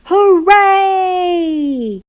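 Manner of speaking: loud, high cheer; shouted hooray. A narrator's reading. Old lady on her bike shouts a happy hooray. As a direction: cheer it out with joy.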